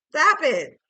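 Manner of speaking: high, loud "stop it"; loud exclamation. Real, not acted. Reader breaks off singing, sudden loud protest. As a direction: burst out with a sharp command.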